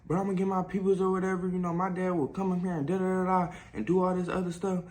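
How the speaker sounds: high, fast mock threat from Chris; mimicking Chris. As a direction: rattle it off high and fast, mocking his threat. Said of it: "he was like".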